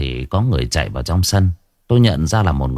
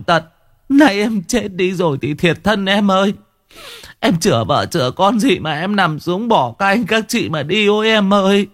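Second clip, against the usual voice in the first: khóc than rền rĩ, giọng cao vút; người chị gào khóc thương em út vừa mất; wailing lament; 12 semitones higher than usual. A narrator's reading. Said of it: "cạnh mâm cơm mà khóc tu tu". (than khóc kể lể, giọng cao thảm thiết)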